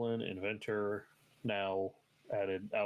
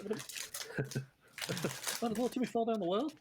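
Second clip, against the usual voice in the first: mock surprised Lassie owner; 13 semitones higher than usual.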